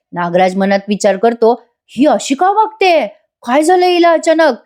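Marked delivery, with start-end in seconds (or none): 1.9-4.5 retold puzzlement. Nagraj wonders about Suman's sudden behaviour, acted bafflement. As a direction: wonder to yourself, pitch raised. Villain's puzzled inner thought.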